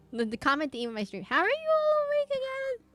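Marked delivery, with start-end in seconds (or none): 1.2-2.9 a sudden very high, cartoonish squeak; squeaky high mock voice. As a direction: pitch it way up like a cartoon character. Repeating the stream's comment theme.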